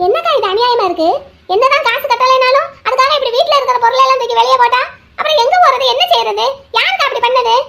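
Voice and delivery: outraged. Acted. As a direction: protest the injustice on a friend's behalf. Friend outraged at the eviction. (ஆவேசமாக நியாயம் கேட்பது)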